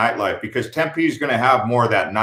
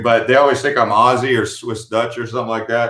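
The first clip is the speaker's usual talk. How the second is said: ordinary talk, nothing marked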